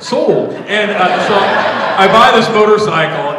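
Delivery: loud laughing exclamation; laughing burst. Real, not acted. Laughing at dad's deal.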